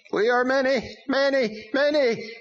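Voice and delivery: high, loud demon voice, gloating; boasting demon. A narrator's reading. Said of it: "the demon boasted".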